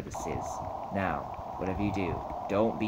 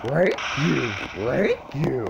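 loud, high talking-dog voice; Scooby-Doo; 6 semitones higher, 6 dB louder, slower than usual. Acted. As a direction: bark it out like a goofy cartoon dog. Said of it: "I can do Scooby-Doo's voice".

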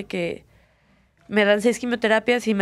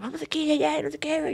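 much higher, sing-song urging; mock chirpy nagging; 7 semitones higher than usual. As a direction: parody the family's eager pushing. Mocking her family's pushing. (imitación burlona y aguda de la familia insistente)

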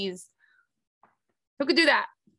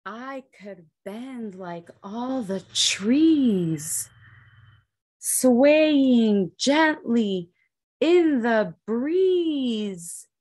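very slow, drawn-out verse; slow swaying recital; 3 semitones lower, much slower than usual. A narrator's reading. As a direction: stretch each word like swaying trees. Reads rhyming verse slowly.

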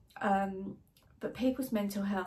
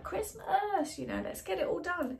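imitating everyone, much higher and sing-song; mock festive cheer, pitched way up; mock cheery crowd; 9 semitones higher than usual. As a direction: chirp it like the festive crowd rushing ahead. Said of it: "Everyone's like".

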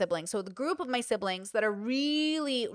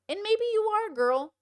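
sassy; 11 semitones higher than usual. Real, not acted. Sassy agreeing with the accusation.

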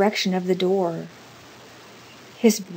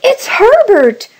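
high, slow, very loud shriek; scream; 13 dB louder, slower than usual. A narrator's reading. Mother realising her dead son knocks, high scream. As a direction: scream it out wildly. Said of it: "she screamed".

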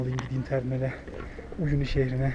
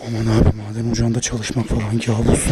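normal talking voice

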